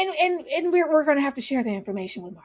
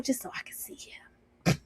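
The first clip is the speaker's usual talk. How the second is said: breathy stage whisper, barely voiced; hushed conspiratorial whisper; 12 dB quieter than usual. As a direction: whisper it like a secret aside. Aside about seeing him.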